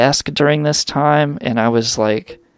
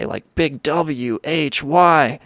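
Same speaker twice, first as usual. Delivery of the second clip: ordinary delivery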